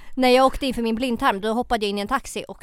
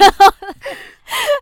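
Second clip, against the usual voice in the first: high burst of laughter; 12 dB louder than usual. Real, not acted. Laughing at friend's remark.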